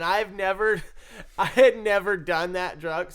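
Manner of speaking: can't stop laughing as you admit it. Real, not acted. Recalling being dosed, laughing it out loud. Laughing while telling drug mishap.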